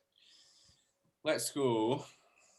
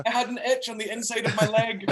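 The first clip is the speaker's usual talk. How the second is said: high mock excuse voice; 10 semitones higher, 6 dB louder than usual. Joking voice of Pompeii man.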